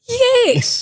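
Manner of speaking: squeaky high yes; a high, clipped imitation of Jack; high mock yes. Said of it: "And Jack's like".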